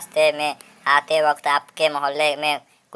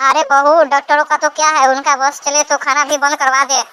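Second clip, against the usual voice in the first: put-on very high-pitched old mother-in-law voice; dismissive mother-in-law; 11 semitones higher, 3 dB louder than usual. Mother-in-law waves away doctors' orders about sweets, dismissive. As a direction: brush off the advice in a high put-on voice.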